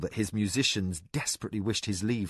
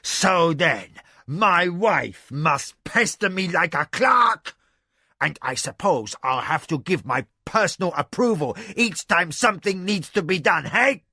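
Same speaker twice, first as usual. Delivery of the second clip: angry tirade; 6 semitones higher, 5 dB louder than usual. Acted. Angry husband berates his wife like a clerk. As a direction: rage at her mockingly. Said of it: "irritation turned to anger".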